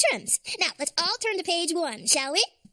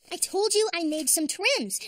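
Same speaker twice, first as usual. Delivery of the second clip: normal talking voice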